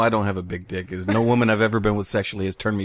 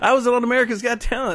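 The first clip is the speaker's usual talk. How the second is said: high cocky voice; 12 semitones higher than usual. Retold or imitated. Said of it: "you're like".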